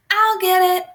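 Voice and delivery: high, loud call; yell. A narrator's reading. Ben races to get to the phone, yelling. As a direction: yell it out, pitched well up. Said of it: "Ben yells".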